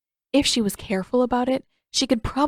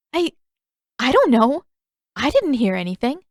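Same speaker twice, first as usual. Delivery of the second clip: nervous denial; 5 semitones higher, 6 dB louder than usual. Acted. Katara lying nervously.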